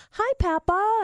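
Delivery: put-on high child greeting; little kid voice. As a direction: chirp it like a kid. Child grandson voice.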